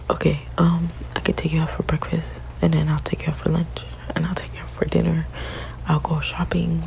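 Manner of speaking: normal talking voice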